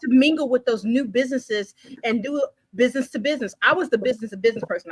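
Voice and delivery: normal talking voice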